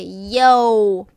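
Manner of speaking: YO with a full English "oh-oo" glide; common wrong way. Shown as a demonstration. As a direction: close YO off with an oo sound. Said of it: "when you pronounce like this, people say".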